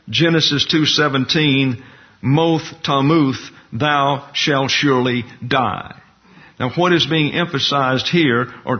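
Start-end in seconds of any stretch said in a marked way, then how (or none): 2.2-5.9 "Moth tamuth" said very slowly, each word weighted and falling; slow emphatic Hebrew reading. Shown as a demonstration. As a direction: lean hard on "Moth tamuth", then "surely die" slow and firm. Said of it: "greater stress on the verb".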